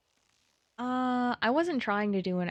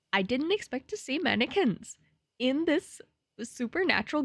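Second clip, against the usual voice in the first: lilting Indian-sounding accent from stress; swooping singsong, like an Indian accent; stressed, accidental Indian accent; 3 semitones higher than usual. Real, not acted. Streamer protesting the mannequins, stressed Indian-sounding lilt. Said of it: "sound like you were doing an Indian accent".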